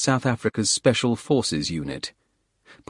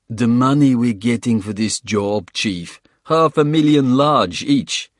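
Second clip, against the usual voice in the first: put-on South African accent; 3 semitones higher, 5 dB louder than usual. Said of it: "South African accent".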